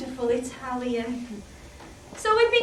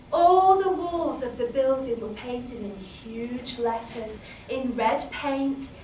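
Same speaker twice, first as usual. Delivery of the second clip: usual speaking voice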